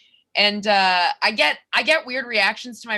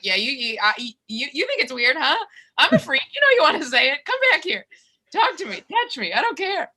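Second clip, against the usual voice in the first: rising, pleading, unhinged calls to a passerby; manic mock plea; 7 semitones higher than usual. Comic to a cat caller, a hammed-up desperate plea. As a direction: beg them to come back, getting more frantic.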